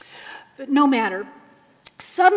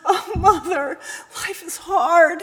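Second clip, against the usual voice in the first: groaning son; 7 semitones higher, 9 dB louder than usual. Acted. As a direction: sob it into her shoulder. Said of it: "he groaned".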